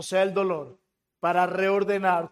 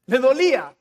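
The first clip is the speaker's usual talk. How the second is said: loud, higher narrated outburst; retold cry out; 5 semitones higher, 7 dB louder than usual. Preacher retelling the doctor's painful pinprick, crying out loudly. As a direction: cry it out like a sudden stab of pain. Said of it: "pega un grito de aquí al cielo".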